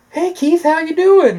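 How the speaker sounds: lightly voiced woman greeting. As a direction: greet him in a girl's voice. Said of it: "she's like".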